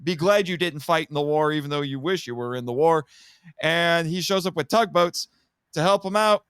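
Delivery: normal talking voice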